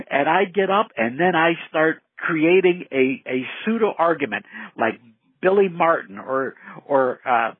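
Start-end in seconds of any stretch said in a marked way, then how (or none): none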